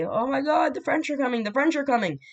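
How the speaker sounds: high-pitched, playful panicked warning; mock alarm. Villagers warning that the French are coming, mock alarm. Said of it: "and they say".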